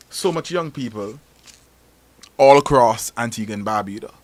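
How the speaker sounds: usual speaking voice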